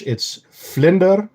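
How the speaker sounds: "vlinder" said slowly in Dutch, with a Dutch v and a rolled r; Dutch word demo. Shown as a demonstration. Streamer to chat, showing the Dutch word, slow and careful. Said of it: "In Dutch".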